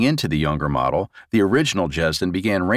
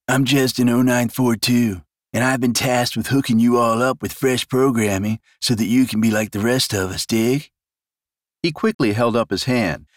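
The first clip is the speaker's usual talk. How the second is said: put-on higher, brash young clone voice; cocky clone; 4 semitones higher than usual. A narrator's reading. Smug clone briefing the new arrivals, cocky. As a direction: swagger it like a smug copy. Clone voice in read-aloud story.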